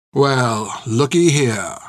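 menacing drawl of discovery; sharp, cruel. A narrator's reading. Soldier finding his quarry from the doorway, cruel. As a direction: cruelly savour the find. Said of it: "said the sharp, cruel voice from the doorway".

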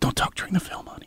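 soft whisper, no voice; whispering to his wife during the film; whispered hush. Retold or imitated. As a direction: hush her like in a cinema. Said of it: "And I'm like".

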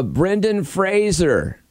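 slow "Fraser" with a Z sound, like razor; rhymes with razor. Shown as a demonstration. Host introducing the next name, rhymes with razor. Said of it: "It actually rhymes with razor at the end".

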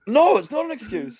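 high, emphatic mock scolding; retold firm rebuke. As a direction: fire back an exasperated agreement.

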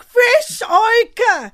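high, loud excited yell; hyped party shout. Retold or imitated. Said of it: "I was like".

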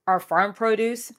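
upbeat sponsor read voice. Acted. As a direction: sell it like a radio spot. Host reads sponsor ad.

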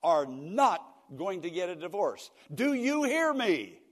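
not hit hard, loud, hear me very high; emphatic scolding. Retold or imitated. Daughter ordering her father, emphatic and loud. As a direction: drive it home to her dad. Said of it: "she emphasized the word not".

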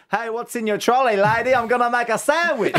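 high-pitched mock child yelling; lightly voiced cheeky kid yelling. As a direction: play the cheeky four-year-old calling out. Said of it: "he yells at people".

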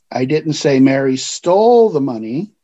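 "stole" punched, the other words even; stress on STOLE. Shown as a demonstration. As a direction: lean hard on "stole". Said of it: "because of the way I emphasized a word".

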